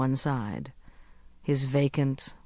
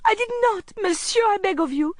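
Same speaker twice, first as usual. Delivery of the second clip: put-on very high, desperate boy's protest; distressed child begging to be believed; gasped pleading; 3 dB louder than usual. A narrator's reading. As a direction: plead frantically, pitched way up. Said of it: "he gasped".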